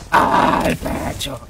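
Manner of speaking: "Al pecho" whispered, very slow and drawn out; slow whispered catchphrase. Acted. Streamer whispering his catchphrase to chat. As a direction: breathe it out slowly like a secret.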